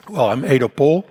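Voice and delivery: ordinary delivery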